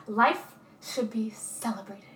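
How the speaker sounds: slow, hushed whisper; soft whispered sincerity. Retold or imitated. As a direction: breathe the line like a secret. Quoting Roberta's heartfelt line.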